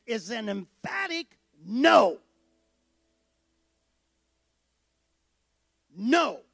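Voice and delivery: slow, forceful stress on "no"; "no" as a loud, firm refusal; emphatic no. Retold or imitated. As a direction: stretch out "emphatic" and land hard on "no".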